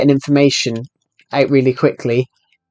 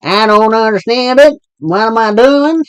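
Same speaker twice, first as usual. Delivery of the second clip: thick Southern American accent; 7 semitones higher than usual. Shown as a demonstration. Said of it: "this really thick Southern American accent".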